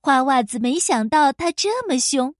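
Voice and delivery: ordinary delivery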